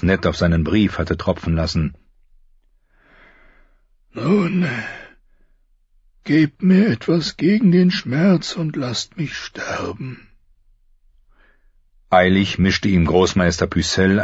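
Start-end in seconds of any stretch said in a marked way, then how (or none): 4.1-10.3 high, strained voice of a dying king. Acted. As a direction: push each word out through pain. Robert asks for relief.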